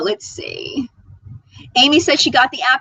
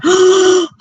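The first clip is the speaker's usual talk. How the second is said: excited gasp; 8 semitones higher, 8 dB louder than usual. Real, not acted. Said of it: "I'm so fangirling right now".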